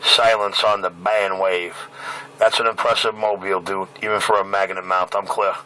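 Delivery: normal talking voice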